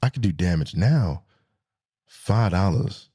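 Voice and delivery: low, subdued and matter-of-fact; deflated. Real, not acted. Driver facing five dollars of gas now, low deflated voice. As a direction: drop down low after the hype. Deflated return to present-day prices.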